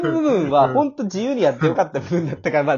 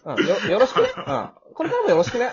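light, laughing, rising "よろしくね"; breezy, flippant; 6 semitones higher, 3 dB louder, faster than usual. Acted. Host thanking the cast in a playful, flippant way. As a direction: toss it off like a playboy. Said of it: "軽い、チャラい".

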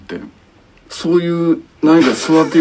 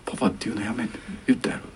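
put-on softer, whispered warning to the child; mother whispering a stern correction to her son; hushed whisper; 4 semitones lower, 10 dB quieter than usual. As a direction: whisper it only the kid can hear. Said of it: "もうちっちゃい声で".

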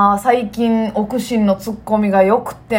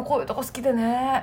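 softly quoted catchphrase; 8 dB quieter than usual. Retold or imitated. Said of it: "って".

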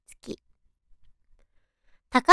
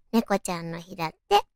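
usual speaking voice

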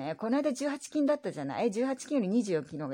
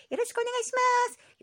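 high squeaky voice; 4 dB louder than usual. Acted. Two voices sign off.

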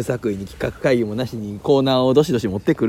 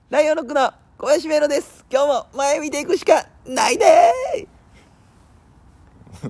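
full-force intro; 12 semitones higher, much slower than usual. Shown as a demonstration. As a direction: belt the opening like a live show. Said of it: "本域の射精から始めてみてくれ". (全力で叫ぶ挨拶)

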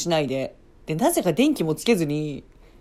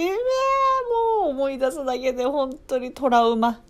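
high drawn-out wail of disgust; dramatic wail; 7 semitones higher than usual. Real, not acted. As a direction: groan it out, high and stretched. Recalling trauma with dramatic voice.

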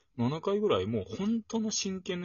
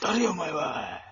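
louder comic retort, held back; restrained shout; 5 dB louder, slower than usual. Retold or imitated. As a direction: yell it but keep it down. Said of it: "っていうのをもっと、 あの、叫びたかったんだけど".

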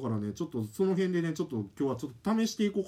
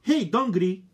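loud, high, gruff-meant call; a half-hearted bad-guy call; tough tone; 6 semitones higher, 7 dB louder than usual. Shown as a demonstration. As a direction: try to sound like a tough guy. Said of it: "ちょっと悪い感じいこうか".

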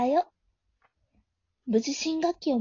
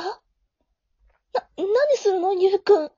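high, loud flustered cry; protest, rattled and embarrassed; 7 semitones higher, 5 dB louder than usual. Acted. Flustered after kiss. (動揺して声を上げる)

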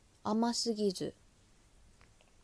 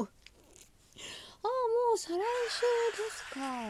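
surprised; 13 semitones higher, 3 dB louder, much slower than usual. Retold or imitated. Retold shock at show date.

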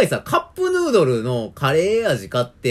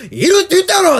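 snapping, much higher and louder; angry yell; 10 dB louder than usual. Retold or imitated. Customer yelling at the clerk who missed his answer, furious. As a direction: yell it the second time round. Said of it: "二回目はなんかすっごい声で".

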